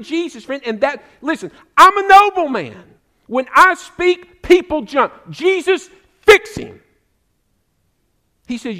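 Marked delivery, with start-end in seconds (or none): 1.2-6.8 loud, high, commanding; a nobleman used to people jumping; entitled demand. Acted. Nobleman ordering Jesus to fix his boy, loud and high.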